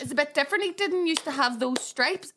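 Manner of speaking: put-on high, slow, puzzled little-girl voice; puzzled child. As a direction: wonder aloud like a confused small child. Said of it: "I remember going".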